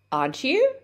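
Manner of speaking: "Aren't you" swung up high on "you"; rising intonation. Shown as a demonstration. English teacher shows how a rising tag invites a reply, rising intonation. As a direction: lift "Aren't you" into a real, interested question. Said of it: "if your intonation rises".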